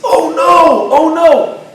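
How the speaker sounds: high, loud, panicked oh no; a man realizing his phone is left behind, shrieking in panic. Retold or imitated. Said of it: "he starts shrieking".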